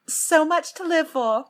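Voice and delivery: slow, high, lightly British title reading; drawn-out mock British; a little British. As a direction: slip into a slightly British accent. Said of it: "I just went a little British".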